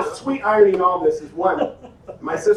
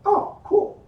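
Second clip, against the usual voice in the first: high, light, casual reaction; imitating an uninvolved viewer; mildly impressed onlooker. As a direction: toss it off in a high voice. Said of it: "you would go".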